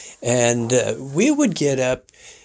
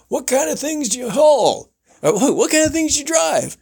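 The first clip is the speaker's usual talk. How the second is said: radio host; 14 semitones higher than usual. Retold or imitated. Host imitated welcoming guest, high showman voice. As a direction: roll it out like a ranch host. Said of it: "talk about something".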